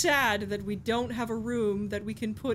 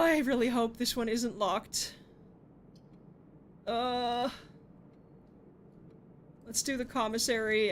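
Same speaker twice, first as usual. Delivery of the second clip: usual speaking voice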